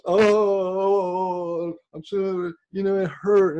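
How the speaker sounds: high, loud mock wail of pain; hurt wailing. Shown as a demonstration. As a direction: moan as if deeply hurt. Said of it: "that's your emotional body taking over".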